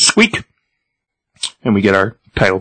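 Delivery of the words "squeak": very high mouse squeak. Retold or imitated. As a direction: squeak like a rat. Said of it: "And he says".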